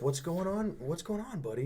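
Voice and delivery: soft, high-pitched check on a friend; gentle concern. Retold or imitated. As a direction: coax a fallen buddy, softly and high.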